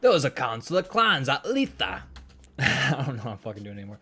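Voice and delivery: a rough hammed-up Cockney; Cockney accent. Leith tells Duncan about the clan council, in Cockney. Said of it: "I'll make Leith Cockney".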